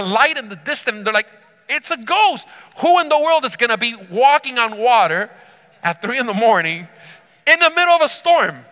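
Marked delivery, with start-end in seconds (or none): 1.7-8.7 high, loud, hammed-up panic; freaking out. Disciples spot a figure walking on water, acted freak-out. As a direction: act out alarmed disbelief, peaking loud and high. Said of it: "So they were freaking out".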